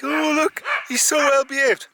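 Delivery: high, loud mock gushing voice; mocking admirers. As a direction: parody people cooing over the dog. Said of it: "Everyone's like".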